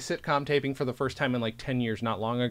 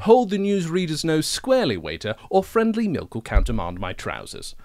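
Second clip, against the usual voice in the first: quoted line redone in a posh-leaning English accent, starting higher and louder; 5 semitones higher, 5 dB louder than usual. Shown as a demonstration. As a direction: deliver the Stephen Fry line as an Englishman. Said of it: "'cause I didn't do it in an English accent".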